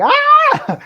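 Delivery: sudden very high, loud mock scream; retold scream. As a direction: let out a game-rage yell. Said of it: "like, legit screaming".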